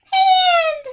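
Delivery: high, loud cry of the rhyme word; shout. Acted. Teacher crying out the rhyme word for kids. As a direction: blurt the word out big and high.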